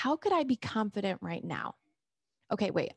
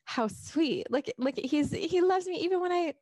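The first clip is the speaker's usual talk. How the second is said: high, touched and tender; sweet delighted; 6 semitones higher than usual. Retold or imitated. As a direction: gush sweetly over his gesture. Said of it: "Or I could have said".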